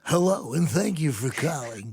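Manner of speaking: put-on automated phone greeting voice; Movie Phone. Dad doing his old phone-line bit for his daughter, announcer voice. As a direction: play the recorded hotline announcer. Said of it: "you also do the movie phone voice".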